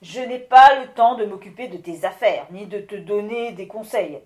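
loud, gruff brush-off; the busy wind lord dismissing the miller gruffly. Acted. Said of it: "dit le Vent d'un ton bourru".

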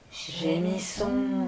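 very slow, low, soft moan; moaning wind. Acted. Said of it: "voix des esprits du vent chuchoter".

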